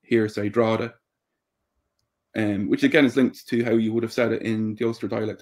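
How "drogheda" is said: "Drogheda" shortened to DRAW-da; local pronunciation. Shown as a demonstration. Showing how people from here say it, "Drogheda" as DRAW-da. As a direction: run "Drogheda" into two syllables, GH silent. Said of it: "but people from here say".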